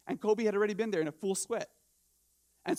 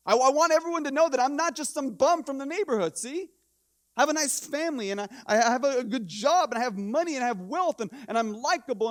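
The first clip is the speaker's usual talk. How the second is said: higher, louder acted insistence; pleading for approval; 5 semitones higher, 5 dB louder than usual. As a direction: insist on your worth, voice pitched up. Voicing an insecure striver seeking approval.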